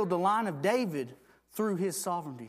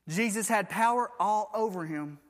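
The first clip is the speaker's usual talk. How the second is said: usual speaking voice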